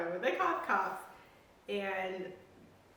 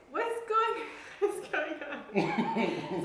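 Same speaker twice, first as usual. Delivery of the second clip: baffled; 7 semitones higher, much slower than usual. Retold or imitated. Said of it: "I'm like".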